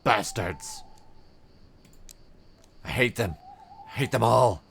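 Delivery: rising bitter rage, then a broken laugh; bitter wounded captain. Acted. Drake cursing the cultists who tortured him, mounting fury.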